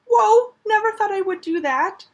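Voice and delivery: high, loud delighted exclamation, half laughing at herself; excited whoa. Real, not acted. Crocheter showing off her square, delighted and amazed. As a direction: gush over your own handiwork.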